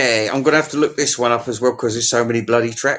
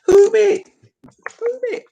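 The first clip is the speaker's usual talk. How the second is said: very high squeak; tiny creature voice; squeaky creature; 4 dB louder than usual. Pretend. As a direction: squeal it like a little critter.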